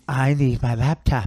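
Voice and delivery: mock whiny son demanding his things; lightly put-on voice of a demanding son; son imitation. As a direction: caricature of a spoiled son. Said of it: "that's how he talks".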